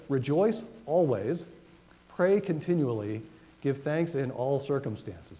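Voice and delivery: slow, weighty reading with each phrase leaned on; slow emphatic verse reading, stressing each phrase; emphatic scripture reading. Acted. Preacher reads verse emphatically.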